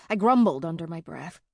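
plain reading voice

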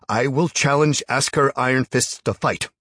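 loud, high, deliberate challenge; firm loud repeat. A narrator's reading. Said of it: "he repeated".

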